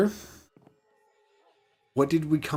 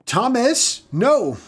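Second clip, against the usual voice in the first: calling out; 11 semitones higher, 4 dB louder, much slower than usual. Real, not acted. Calling out to fleeing villager.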